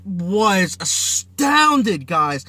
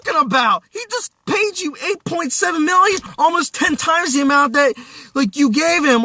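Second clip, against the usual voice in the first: incredulous; 7 semitones higher than usual. Retold or imitated. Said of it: "it's like".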